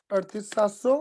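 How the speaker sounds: ordinary delivery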